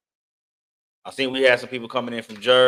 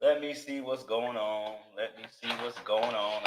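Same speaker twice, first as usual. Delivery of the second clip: ordinary talk, nothing marked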